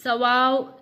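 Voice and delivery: ordinary talk, nothing marked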